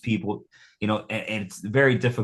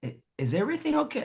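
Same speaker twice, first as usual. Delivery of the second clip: high, soft tentative question; imitating girlfriend; 12 semitones higher, 4 dB quieter, much faster than usual. Imitating girlfriend checking on him, tentative. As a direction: mimic her cautious check, pitched way up. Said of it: "and she's like".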